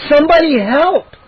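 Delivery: high, loud panicked call; scared call for help. A narrator's reading. Boy calling for help among scorpions. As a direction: shout for anyone to come.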